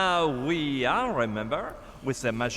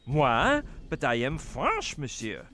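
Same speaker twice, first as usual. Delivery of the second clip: fake French accent; 2 semitones lower than usual. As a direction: insist you're French in a shaky put-on accent. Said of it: "I can't quite figure out your accent".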